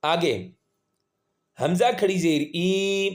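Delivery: normal talking voice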